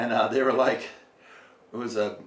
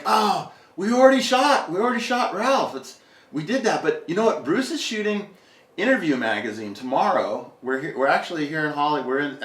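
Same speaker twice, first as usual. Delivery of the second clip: eager photographer; 6 semitones higher, 4 dB louder than usual. Retold or imitated. Photographers invite him on phone.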